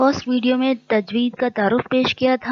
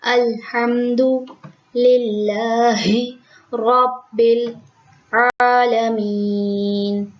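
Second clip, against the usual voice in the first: slow, measured tajweed chant; Quranic recitation; much slower than usual. Shown as a demonstration. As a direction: recite the verse as in prayer. Recites whole verse in tajweed.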